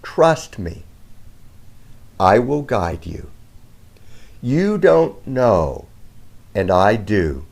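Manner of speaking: slow, low, reverent reading of a sacred message; solemn reading. Acted. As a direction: speak each line slowly as God's own words. Reads message as if from God.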